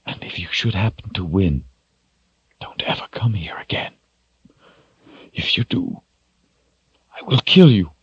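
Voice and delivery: hushed, halting, emotional warning; voice breaking. A narrator's reading. Shota warns Richard never to return, voice breaking. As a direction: start breathy and choked, build to the threat. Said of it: "in a voice that broke with emotion".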